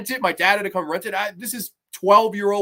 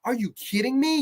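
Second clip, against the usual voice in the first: normal talking voice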